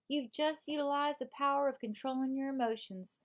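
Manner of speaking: lively Southern self-help character; Southern accent. Pretend. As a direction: read it out in a put-on Southern accent. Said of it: "I'm reading this with a Southern accent".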